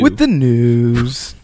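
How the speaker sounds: slow, drawn-out show announcer. Pretend. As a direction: stretch it out like a radio jingle. Co-host cues the news segment.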